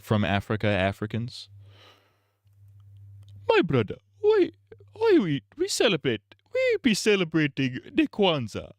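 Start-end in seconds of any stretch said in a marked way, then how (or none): 3.5-8.7 mock Nigerian accent. Streamer's mock Nigerian accent questioning Kwanzaa, very high. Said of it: "the Nigerian impression".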